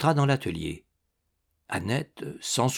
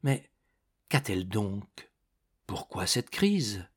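soft, low inner question; hushed, private bewilderment; wondering to himself; 3 semitones lower, 6 dB quieter than usual. A narrator's reading. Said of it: "il se demanda".

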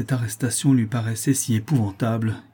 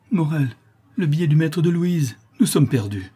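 higher, anguished alarm; understated wail of ruin; heart-rending cry; 6 semitones higher, 3 dB louder than usual. A narrator's reading. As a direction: cry out to a husband in despair. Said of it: "d'une voix déchirante".

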